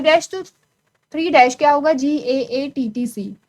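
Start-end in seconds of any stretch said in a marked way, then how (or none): 1.9-3.4 slow spelling. Shown as a demonstration. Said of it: "spells out the sequence".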